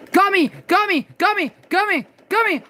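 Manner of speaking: high frantic cries of alarm; panicked yelling. Real, not acted. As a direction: shout it in a panic. Panicked calling out in game.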